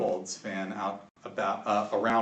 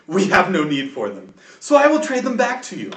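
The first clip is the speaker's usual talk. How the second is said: booming, higher dragon voice, loud and proud; 7 semitones higher, 7 dB louder than usual. Acted. GM voicing the half-dragon to the defenders, booming dragon voice. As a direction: gloat like a generous dragon lord. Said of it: "Do your best dragon".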